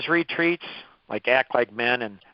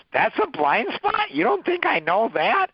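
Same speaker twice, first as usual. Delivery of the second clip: indignant retort; 9 semitones higher than usual. Real, not acted. Mocking the blind spot remark.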